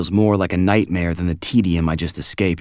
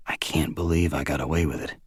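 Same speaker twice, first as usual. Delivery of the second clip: ordinary delivery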